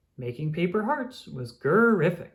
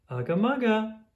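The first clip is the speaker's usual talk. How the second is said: tender mom; 7 semitones higher than usual. Acted. Mom's affectionate nose rub.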